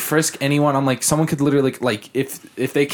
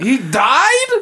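mock shocked question; 12 semitones higher, 5 dB louder than usual. Shocked at dying painter.

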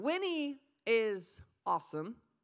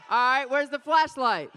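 laughing call; 4 semitones higher, 12 dB louder than usual. Real, not acted. Preacher calling out, laughing.

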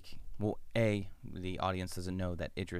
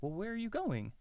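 high, softer, nosy question; prying; 12 semitones higher, 5 dB quieter than usual. Retold or imitated. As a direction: fish for where he is heading. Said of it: "He's like".

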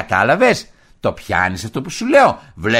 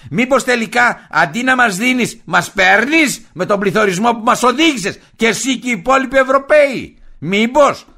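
angry accusing rant; 8 semitones higher, 3 dB louder than usual. Acted.

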